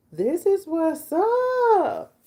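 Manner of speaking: high, slow, drawn-out delighted exclamation; delighted reaction. Retold or imitated. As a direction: savour it like a tasty discovery. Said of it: "I was like".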